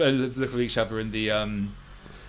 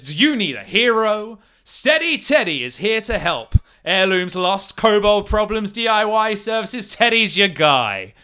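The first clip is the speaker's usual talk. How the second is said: high, upbeat ad-man pitch; cheesy advert read; 6 semitones higher, 3 dB louder than usual. Acted. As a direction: sell it like a cheap flyer. GM reads poster as advert.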